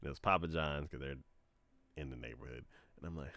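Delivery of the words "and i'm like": ordinary delivery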